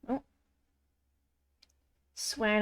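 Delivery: normal talking voice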